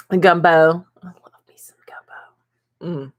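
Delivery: slow whispered craving. Real, not acted. Telling viewers her love of gumbo, whispered. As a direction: whisper it like you can taste it.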